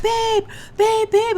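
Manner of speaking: high loud frantic calling; frantic yelling. Retold or imitated. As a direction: yell for your partner in panic. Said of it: "like a crazy person".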